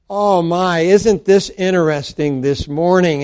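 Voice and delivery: normal talking voice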